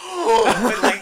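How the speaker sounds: high loud laughing gasp; shocked gasp. Retold or imitated. Shocked gasp retelling Tyler.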